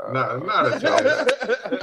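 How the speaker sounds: slow, high laughing reply; words stretched out by laughter. Real, not acted. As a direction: laugh your way through the denial. Laughing at question.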